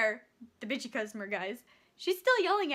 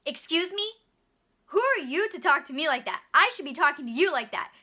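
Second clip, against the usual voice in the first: retorts in an offended, raised voice; indignant outburst; 3 semitones higher, 7 dB louder than usual. Retold or imitated. Customer scolds cashier, louder and indignant. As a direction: bristle and hit back at the cashier.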